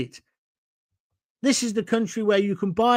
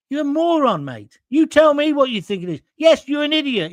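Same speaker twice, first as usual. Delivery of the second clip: raised, higher, louder insults; angry retort; 7 semitones higher, 4 dB louder than usual. Real, not acted. Snapping back at a rude commenter, high loud insults. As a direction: let him have it, loud and high.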